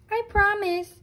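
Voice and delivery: put-on a light, high girlish vow; Goldilocks voice. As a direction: promise like an innocent little girl. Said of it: "said Goldilocks".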